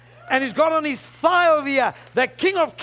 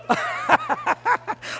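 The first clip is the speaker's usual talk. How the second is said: high-pitched cackling laugh; rapid, high burst of laughter; excited laugh. Real, not acted. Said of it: "I'm getting excited here".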